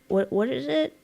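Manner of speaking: ordinary delivery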